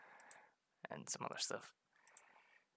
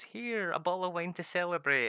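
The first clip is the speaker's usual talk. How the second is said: higher, louder cheerful wife; mock wife voice; 7 semitones higher, 8 dB louder than usual. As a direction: chirp it as the wife. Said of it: "she always goes".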